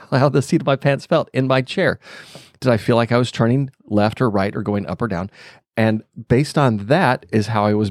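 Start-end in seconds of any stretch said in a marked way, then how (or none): none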